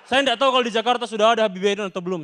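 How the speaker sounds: ordinary talk, nothing marked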